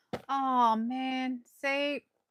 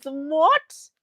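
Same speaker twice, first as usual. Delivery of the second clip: disbelieving what; 9 semitones higher, 6 dB louder than usual. Real, not acted. Disbelief at blue Art.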